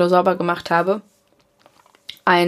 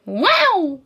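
mock amazed wow; 10 semitones higher than usual. Real, not acted.

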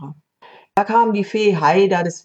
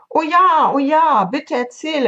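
high, loud eager pleading; eager begging; 9 semitones higher, 3 dB louder than usual. A narrator's reading. Parrot begs the monkey to tell a story, excited. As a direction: jump at the offer like an excited child. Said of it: "erwiderte die Papageien".

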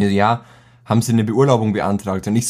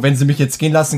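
ordinary talk

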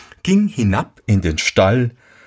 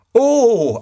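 lügender Sohn; 13 semitones higher than usual. Acted. Lügender Sohn antwortet dem Vater.

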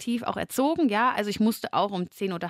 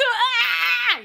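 high, loud shriek of disgust; woman screaming on finding vomit in her hair; 3 dB louder than usual. Retold or imitated.

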